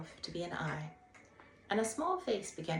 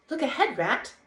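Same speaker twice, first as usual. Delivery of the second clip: loud, higher warning shout; sudden cry; 8 semitones higher, 11 dB louder than usual. Acted. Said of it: "cried the mole suddenly".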